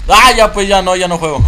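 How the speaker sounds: loud, high cry of giving up; rattled protest. Real, not acted. Player refuses to keep playing, rattled protest.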